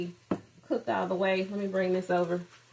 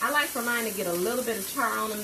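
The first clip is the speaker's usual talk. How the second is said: ordinary delivery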